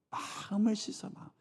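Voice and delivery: usual speaking voice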